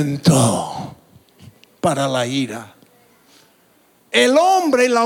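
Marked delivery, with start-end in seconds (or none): 0.0-2.7 "Lento para la ira" estirado, grave y lento; dicho lento. Shown as a demonstration. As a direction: alarga cada sílaba de "Lento para la ira", más grave. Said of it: "pero dilo lento". ("Lento para la ira" pronunciado muy despacio y grave)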